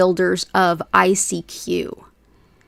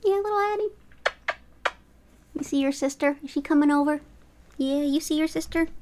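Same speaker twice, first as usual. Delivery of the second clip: pet talk; 7 semitones higher, 5 dB quieter than usual. Real, not acted. Coaxing her cats Hattie and Bubba over, very high soft baby talk. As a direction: coax the kitty over in a high sing-song. Cooing at second cat.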